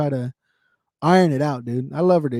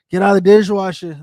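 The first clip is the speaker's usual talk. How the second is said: retold yell at kids; 4 semitones higher, 10 dB louder than usual. Yelling at kids in kitchen.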